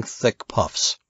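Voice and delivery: ordinary delivery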